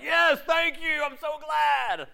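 high, slow, sugary mock thanks; mock grateful gushing. Preacher mocking a prosperity believer, high mock gratitude. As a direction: gush over a gift you secretly hate.

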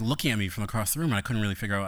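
normal talking voice

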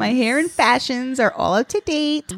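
mock Fashion Plates commercial; eighties toy commercial. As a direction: sell it like a retro toy ad. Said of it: "you sound like the Fashion Plates commercial".

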